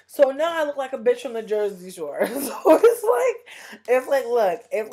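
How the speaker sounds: loud, incredulous laugh breaking into the words. Real, not acted. Recounting being mistaken for Jersey Shore, laughing. Laughs at own absurd situation.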